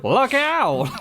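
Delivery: high, loud Look out; overacted dub warning cry; a hammed-up cartoon alarm; mock shout.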